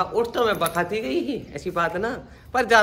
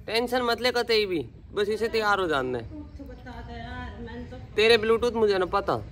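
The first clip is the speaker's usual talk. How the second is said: ordinary talk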